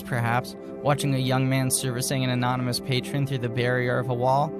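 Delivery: ordinary delivery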